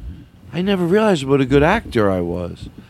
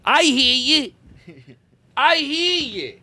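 high, slow, drawn-out call; 9 semitones higher, slower than usual. Pretend. Calling across rooms sketch.

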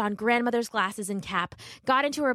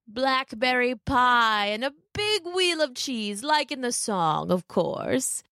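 slow, sly wolf voice; 4 semitones higher, slower than usual. Acted. Wolf coaxing Little Red on the path, slow and sly.

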